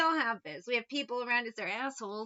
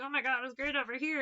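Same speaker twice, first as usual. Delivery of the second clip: fast, giddy mock gush; mock fantasist; 3 semitones higher, faster than usual. Mocking a person playing pretend with themselves, gushing. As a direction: gush like someone lost in a daydream. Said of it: "playing pretend with themselves".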